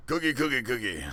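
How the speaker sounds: a Chappelle bit, hammed up; Chappelle impression. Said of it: "I can do David Chappelle".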